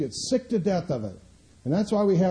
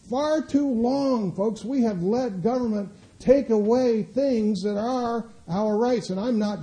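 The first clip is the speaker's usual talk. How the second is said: much higher, slow and drawn out on the opening words; slow rallying preach; 9 semitones higher, 5 dB louder than usual. Acted. Preacher calling the folks to reclaim rights, slow and much higher. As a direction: stretch it out like a call to arms.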